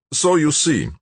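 lightly voiced smug official; a hint of Malik. A narrator's reading. Malik's voice in the story.

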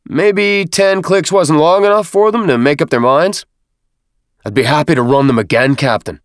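high, loud needling of the troops; sergeant's loud taunt. A narrator's reading. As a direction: taunt the recruits through a remark to the captain. Snarling sergeant goes on loudly before recruits.

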